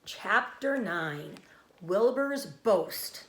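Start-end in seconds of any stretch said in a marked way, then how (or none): none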